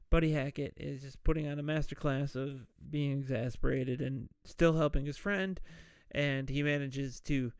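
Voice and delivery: usual speaking voice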